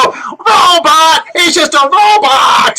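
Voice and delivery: put-on high, excited kid yell; kids shouting out. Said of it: "when kids see that, don't they just go".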